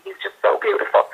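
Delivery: high, whispered, choked-up gush; hammed-up tender awe, almost crying; teary whisper. Moved by an old man at Trader Joe's, teary whispered gush. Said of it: "'cause I was just like".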